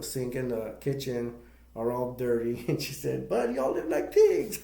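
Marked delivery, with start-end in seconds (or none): 3.3-4.6 high-pitched imitation of a scolding; mock scolding. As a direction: retell it as a high, louder squawk of reproach. Quoting aunt scolding Bud.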